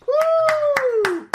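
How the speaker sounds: high-pitched "woo" of applause; delighted high whoop; high cheering whoop. Real, not acted. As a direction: whoop in approval. Cheering the performance.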